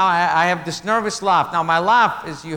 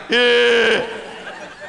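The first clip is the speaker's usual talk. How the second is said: nervous laugh; 4 semitones higher than usual. Shown as a demonstration. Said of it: "standing there going".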